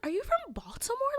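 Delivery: high, put-on valley voice. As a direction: imitate her surprised question. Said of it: "she was like".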